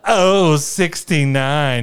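very slow, drawn-out, high and loud call; milk every syllable like a bingo caller. Retold or imitated. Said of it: "just, like, really emphasize that".

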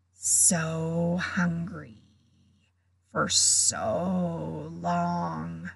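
low, very slow, hungry monster voice; cold murderous voice. A narrator's reading. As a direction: drag it out like a starving beast. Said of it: "the same cold, murderous voice".

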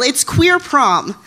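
ordinary talk, nothing marked

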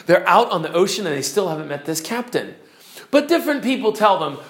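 ordinary talk

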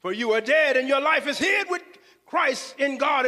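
ordinary talk, nothing marked

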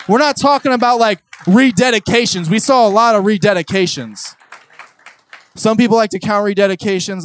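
high, loud preaching build; full-force preaching. Acted. Preacher telling the crowd the day chop ended, full force.

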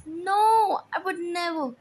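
high, loud then shrinking no; frightened denial. A narrator's reading. Seamstress pleading with her angry husband, afraid. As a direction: plead your innocence in fear.

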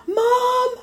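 put-on very high sing-song call of a boy; imitating a child calling his mom; son calling. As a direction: call out like an excited kid. Son calling out coming home.

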